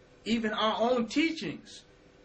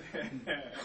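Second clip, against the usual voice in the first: short laugh; 6 semitones lower, 9 dB quieter than usual. Real, not acted. Short laugh after his point.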